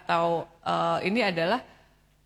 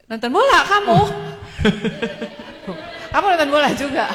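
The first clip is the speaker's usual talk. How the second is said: teasing question; 5 semitones higher, 5 dB louder than usual. Real, not acted. Teasing boys who watched football.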